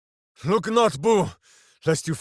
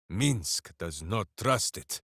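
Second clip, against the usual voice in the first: ordinary talk, nothing marked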